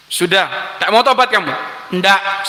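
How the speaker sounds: ordinary talk, nothing marked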